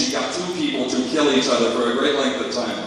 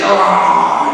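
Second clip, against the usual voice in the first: loud yell; 8 semitones lower, 7 dB louder than usual. Retold or imitated. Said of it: "he's like".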